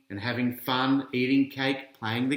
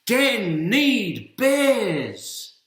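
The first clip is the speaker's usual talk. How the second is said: calling out; 9 semitones higher, 6 dB louder, much slower than usual. A narrator's reading. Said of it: "he says".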